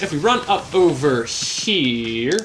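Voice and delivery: ordinary delivery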